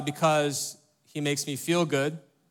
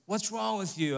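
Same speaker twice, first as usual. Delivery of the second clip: softer, gentler take on the scolding question; softened rebuke; 5 semitones higher, 6 dB quieter than usual. Shown as a demonstration. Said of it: "that may sound a bit harsh".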